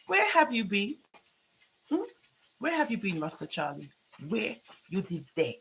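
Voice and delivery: usual speaking voice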